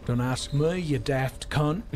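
slow, shouted bad Aussie accent; terrible Aussie insult, shouted; Australian accent. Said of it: "worst Australian accent I've ever done".

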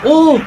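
sudden high yelp of fright; startled yelp. Real, not acted. Watching a creepy VR video, a startled high yelp. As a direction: jolt out a short high "Oo!".

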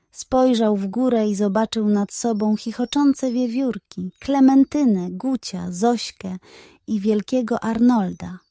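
usual speaking voice

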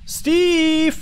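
high loud shout; calling out loud. Real, not acted. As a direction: yell the name. Calling ghost in game.

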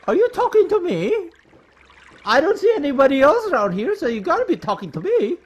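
high, loud mock-challenging voice; wrong character voice. Player goofing on "talking to me", hammed-up loud voice. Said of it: "That's not Gemma's voice either".

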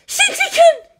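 very high, loud outburst; desperate cry. Acted. Captive woman at breaking point, desperate cry. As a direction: shriek the name in anguish.

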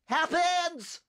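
very high, sudden yell; alarmed shout. A narrator's reading. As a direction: cry out as something blows up. Cut off by explosion.

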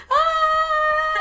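high, loud, held shriek; imitated scream. Sister beside her fainting brother, imitated scream.

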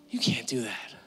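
whispered, then gently voiced; gentle whisper. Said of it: "gently and clearly say to us".

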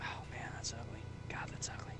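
unvoiced whisper of dismay. Real, not acted. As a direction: breathe it out in a whisper. Reacting to a bad sight.

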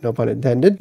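No pun intended dropped low and soft with a small rise; muttered aside. Real, not acted. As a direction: mutter No pun intended under your breath. Joke aside, muttered take.

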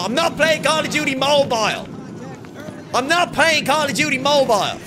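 high-pitched, indignant refusal; emphatic refusal. Real, not acted. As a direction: refuse it outright, pitched well up. Emphatic refusal to chat's suggestion.

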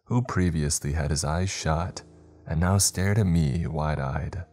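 plain reading voice

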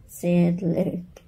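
ordinary delivery, nothing marked

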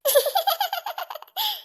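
high teasing giggle; cheeky giggle. Acted. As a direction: giggle at her sister's trouble. Teasing laughter. (കളിയാക്കി ചിരിക്കുന്നു)

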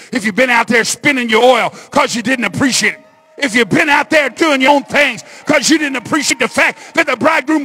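loud, high sermon building to a shout; rousing, driving preacher's cadence; fiery preaching. Real, not acted. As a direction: build in pitch and force, phrase on phrase. Preaches at full force.

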